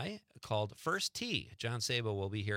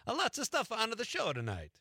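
slipping back into the Italian accent, higher; a light Italian lilt, pitched up; 10 semitones higher than usual. Shown as a demonstration. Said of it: "I did it again".

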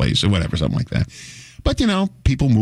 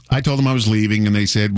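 ordinary talk

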